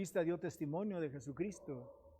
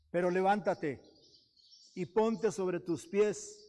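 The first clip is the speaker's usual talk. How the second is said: preaching at full force; 2 semitones higher, 4 dB louder than usual. Acted. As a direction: proclaim it like a command from above. Preaching the Lord's words.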